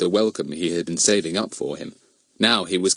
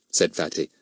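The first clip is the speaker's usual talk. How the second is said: plain reading voice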